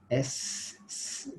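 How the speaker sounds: "S" com o som de s prolongado; S sibilante. Shown as a demonstration. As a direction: demonstre "S, s" deixando o ar sair pressionado. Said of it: "percebe que o ar sai, sai pressionado". ("S" soprado, ar espirrado entre língua e palato)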